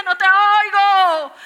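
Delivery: high, very loud drawn-out cry; desperate screaming. Retold or imitated. Woman yelling to a God she can't hear, screaming. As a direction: scream it out, stretching the words. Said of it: "A grito herido".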